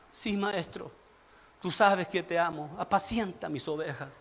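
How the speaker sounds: very low, soft acted voice; subdued, tender exchange; meek reply. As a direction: drop to a humble murmur. Acting Peter's reply, then Jesus's.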